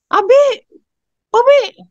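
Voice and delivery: put-on high, loud child's call across the house; child yelling. Teacher imitating a child screaming for father, loud high yell. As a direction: yell for Dad like an impatient kid. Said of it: "teriak-teriak".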